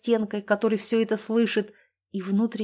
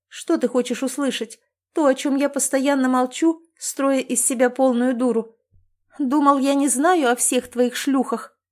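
louder, higher, bitter reproach; angry accusation; 5 semitones higher, 4 dB louder than usual. A narrator's reading. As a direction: throw his affairs in his face. Wife confronts cheating husband. (гневный упрёк, громче и выше)

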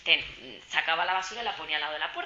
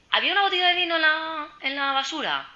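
high, loud, scandalised mock question; acted shocked question; 11 semitones higher, 6 dB louder than usual. Moroccan colleague asking about the rubbish, high loud mock shock. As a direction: imitate a colleague's alarm, pitched right up.

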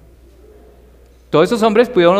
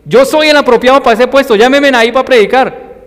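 higher, louder, puffed-up self-praise; boastful mock claim; 9 semitones higher, 6 dB louder than usual. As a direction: play the smug volunteer who thinks he's chosen. Mocking a self-promoting preacher.

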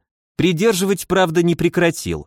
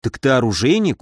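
usual speaking voice

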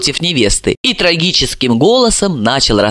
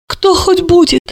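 high, hopeful asking; hopeful question; 13 semitones higher than usual. A narrator's reading. Mother asking about the unborn baby, hopefully. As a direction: ask with a raised, wishful lilt. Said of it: "с надеждой спросила".

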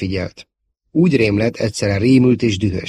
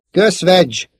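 ordinary delivery

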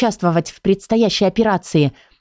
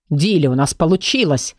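plain reading voice

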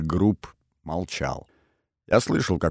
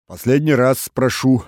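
louder, higher displeased warning; 6 semitones higher, 6 dB louder than usual. A narrator's reading. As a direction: give a loud, impatient last warning. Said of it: "недовольно произнёс голос". (недовольно, повышенным голосом)

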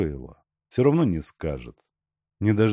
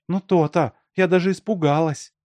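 high-pitched relieved reproach; relieved woman; 9 semitones higher, 5 dB louder than usual. A narrator's reading. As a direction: let the scare go, voice still high. Woman relieved after his joke. (с облегчением, высоким голосом)

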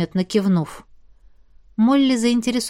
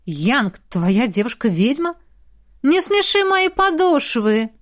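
Molly's scoffing voice, pitched up; mocking Molly; 4 semitones higher than usual. Molly laughing off Jet's girlfriend, mocking high voice. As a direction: scoff at the idea.

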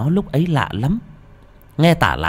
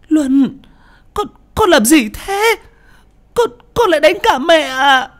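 high, loud desperate wailing; a terrified mother crying out to her son; crying scream; 5 dB louder than usual. Acted. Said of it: "Cô Lan hét lên / Vừa nói cô vừa khóc".

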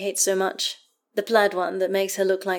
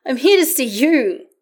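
high, loud, delighted call; excited announcement; 7 semitones higher, 9 dB louder than usual. A narrator's reading. Karlach here to see Astarion, excited. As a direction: burst out with the good news.

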